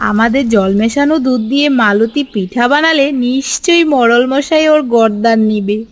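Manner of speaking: normal talking voice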